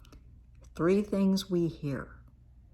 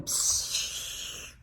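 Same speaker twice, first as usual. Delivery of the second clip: long hissing rush of escaping air; imitated balloon flying off; deflating balloon noise. Shown as a demonstration. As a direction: let the air hiss out like a loosed balloon. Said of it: "flies through the air going".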